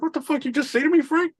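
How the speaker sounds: high-pitched mock outrage; incredulous. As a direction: imitate a customer who can't believe his ears. Acted incredulous customer, after giggling.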